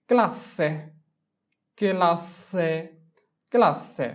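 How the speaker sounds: ক্লাসে with a hard kl, KLA-se; repeated model of ক্লাসে; Italian pronunciation. Shown as a demonstration. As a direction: drill ক্লাসে three times. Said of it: "তাহলে হবে চি এললে".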